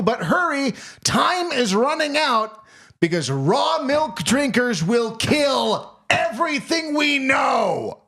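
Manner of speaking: high, building doomsday pitch; hammed-up warning rising to a shout; rally listeners with escalating melodramatic urgency; mock alarmist ad.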